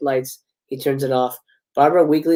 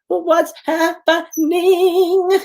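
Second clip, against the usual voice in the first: very slow, high, thin plea; weak, frightened; 11 semitones higher, much slower than usual. Acted. Barbara asks the truck driver, weak, high and very slow. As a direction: ask it like a scared child.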